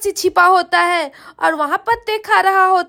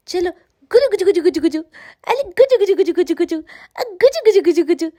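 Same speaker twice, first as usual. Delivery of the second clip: quick playful Gujju Gujju tickle noises; fast teasing coo; tickling babble; faster than usual. Acted. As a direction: rattle off Gujju Gujju like tickling a baby. Tickling baby's ear to wake him.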